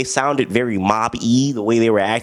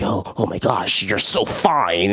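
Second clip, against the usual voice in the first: mock lovestruck mumble; 5 semitones lower, slower than usual. Mocking a lovestruck movie line.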